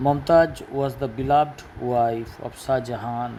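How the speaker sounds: drawn-out reading; slow model sentence. Shown as a demonstration. Teacher reading the example sentence slowly to pupils. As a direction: read it slowly for the class.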